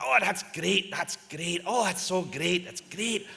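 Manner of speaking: high, loud, gushing congratulations; over-the-top delighted, high voice; gushing praise. Retold or imitated. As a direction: pump it up like a thrilled old boss. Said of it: "He's going".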